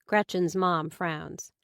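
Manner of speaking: plain reading voice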